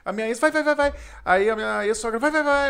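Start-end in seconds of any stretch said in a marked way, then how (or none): 0.4-0.9 high, louder repeated go; mock egging on. His ex pushing him to go, high insistent urging. 2.2-2.7 high, louder repeated go; mock egging on. His ex pushing him to go, high insistent urging.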